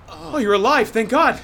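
high, breathless relief; relieved gasp. Acted. Relief after crash.

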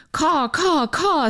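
high cawing crow imitation. Host voicing the crow in her poem, high caw. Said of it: "says the crow".

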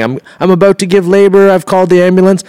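high-pitched mock labor announcement; urgent retold call. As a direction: play the wife urgently phoning her husband.

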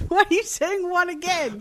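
high-pitched incredulous complaint; exasperated. Real, not acted. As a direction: demand an explanation, voice shooting up. Count-in botched again.

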